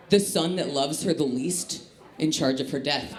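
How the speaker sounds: ordinary delivery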